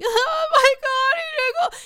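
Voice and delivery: high squeal, crying out with laughter; squealing girly excitement. Retold or imitated. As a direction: shriek like a smitten girl. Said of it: "I'm hella girl, and I'm like".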